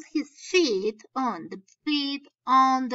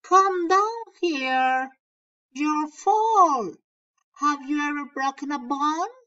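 yelled warning; 4 semitones higher, 4 dB louder than usual. Acted. Boy yelling up at his friend on the rocks, loud and high. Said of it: "I yelled".

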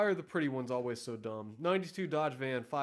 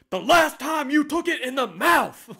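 high, loud bellow at the trainee; furious shout; 12 semitones higher, 15 dB louder than usual. Retold or imitated. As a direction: blow up at the dopey trainee. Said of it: "And he finally goes".

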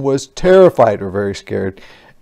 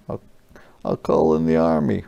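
plain reading voice

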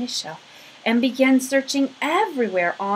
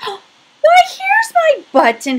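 much higher, loud joyful cry; delighted cry; 11 dB louder, slower than usual. Acted. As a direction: bear overjoyed to find his button. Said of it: "he cried".

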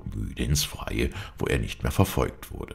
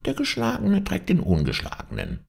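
high, thin fox singsong to himself; sly fox aside in falsetto; talking to himself. A narrator's reading. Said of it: "sprach im Fortgehen so vor sich hin".

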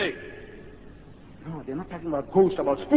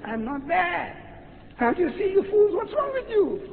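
rising higher and higher in exasperation; exasperated rebuke; 12 semitones higher than usual. Acted. Jesus rebuking the frightened disciples, rising exasperation. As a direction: scold the fools who cannot see.

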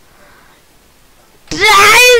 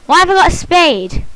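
ordinary talk, nothing marked

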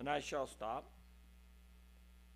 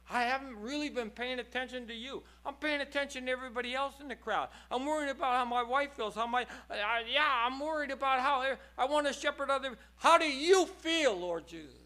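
higher and louder preaching voice; impassioned preaching; 8 semitones higher, 7 dB louder than usual. Acted. Preacher challenging the congregation, impassioned. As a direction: drive every phrase home.

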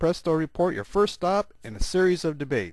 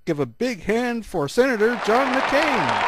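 announcer; 9 semitones higher, 5 dB louder than usual. Acted. Host introducing candidate.

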